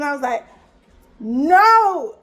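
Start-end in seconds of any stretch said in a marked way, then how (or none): 1.2-2.2 loud, higher-pitched no; emphatic refusal. Retold or imitated. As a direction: act out shutting down an offer. Retold refusal of his offer.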